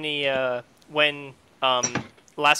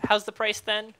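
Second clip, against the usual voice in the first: sweet charming tone; 7 semitones higher, 4 dB quieter than usual. Acted. Character charming shopkeeper.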